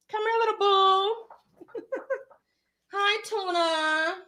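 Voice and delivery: high, sweet pet-talk voice. Real, not acted. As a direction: coo at the puppy. Calling her dog over.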